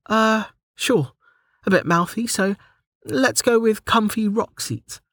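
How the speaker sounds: high-pitched heroine voice, casual; Delta suggesting. A narrator's reading. Delta settles on a name with the menu, high suggesting voice. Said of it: "she suggested".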